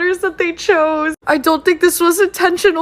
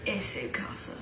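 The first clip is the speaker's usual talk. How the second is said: whispered phrase; 10 semitones lower, 17 dB quieter than usual. Acted. Reads sign softly.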